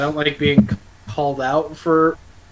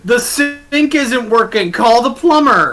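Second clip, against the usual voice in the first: mock shout; 8 semitones higher, 7 dB louder, slower than usual. Mishearing sync as sink, joking.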